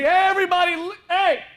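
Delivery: put-on high, loud Indian-accented greeter in heaven; heavenly greeter with an Indian accent. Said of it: "Oh, the Indian accent".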